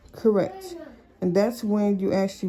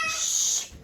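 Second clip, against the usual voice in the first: shushing. Real, not acted. Mother shushing her kids during testimony, sharp shush.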